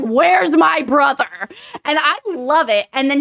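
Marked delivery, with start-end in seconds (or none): 0.0-1.3 loud, slow demanding question; fierce demand. Retold or imitated. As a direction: grab him and demand it. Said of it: "swings him around and is like".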